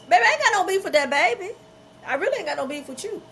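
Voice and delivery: high, fast, laughing and loud; laughing reply. Retold or imitated. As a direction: laugh it off, waving away any grudge. Laughing reply to baby mama.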